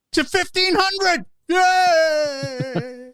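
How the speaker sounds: loud, high celebratory shout; high-pitched mock hooray; mock cheer. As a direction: cheer it like a big win, drawing out the yay. Mock celebration of viewing figures.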